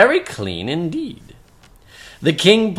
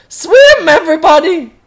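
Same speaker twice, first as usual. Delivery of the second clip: shouted high and loud with a laugh; urgent cry; 12 semitones higher, 8 dB louder than usual. Acted. Gabu calls everyone as the water reaches their chins, loud laughing shout. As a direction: call out to the drowning crowd. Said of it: "cried Gabu".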